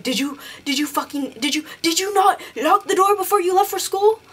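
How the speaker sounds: imitating a panicky mom, pitch climbing high; retold freak-out. Mom questions her kid about the unlocked door, mock freak-out. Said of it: "freaking out".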